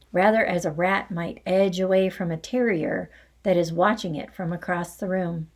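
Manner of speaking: ordinary delivery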